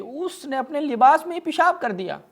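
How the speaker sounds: high incredulous outcry; mock-shocked exclamation. Real, not acted. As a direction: exclaim it like an unbelievable scandal. Shocked punchline of story.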